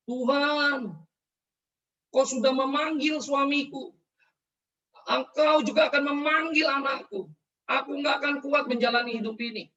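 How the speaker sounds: high, loud anguished plea; tearful pleading prayer. Retold or imitated. Son imitating his kneeling mother, crying out in prayer. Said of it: "Dia berlutut menangis".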